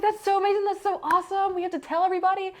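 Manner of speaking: high, gushing imitation of a coach; excited coach. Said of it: "she was very excited for me".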